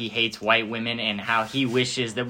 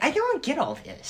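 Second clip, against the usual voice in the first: put-on old grandma voice.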